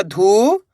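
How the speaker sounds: धू held long, swooping up; held syllable. Shown as a demonstration. Teacher sounding out one syllable, धू drawn long. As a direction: stretch धू out on its own. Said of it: "एक अक्षर का उच्चारण करना है".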